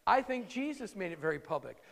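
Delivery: normal talking voice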